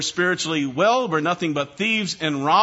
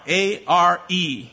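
spelling out; slower than usual. Shown as a demonstration. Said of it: "the word are".

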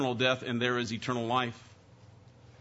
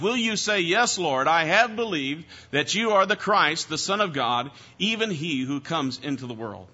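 preaching at full force; 4 semitones higher than usual. Acted. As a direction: press the question on the congregation. Preaching a sermon.

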